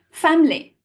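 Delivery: "Family" glossed over as FAM-lee; English schwa pronunciation. Shown as a demonstration. Teacher contrasts English with mock Italian, "Family" shrunk to FAM-lee. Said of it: "We almost kind of gloss over it".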